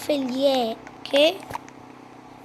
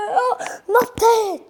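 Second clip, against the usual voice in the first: 1 laughing; 7 semitones higher than usual. Real, not acted. Character jumps at last.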